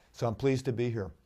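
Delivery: ordinary delivery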